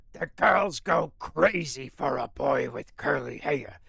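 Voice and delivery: put-on slow, deep old New England lady's voice; old New England accent. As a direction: drawl it like a ninety-year-old New England neighbor. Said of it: "the old New England accent".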